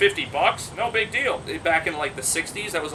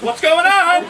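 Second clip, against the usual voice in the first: high, loud comic cry; mock alarm; 13 semitones higher, 9 dB louder, much slower than usual. Monkey clinging to a running bulldog, mock-alarmed. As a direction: yelp it in playful panic.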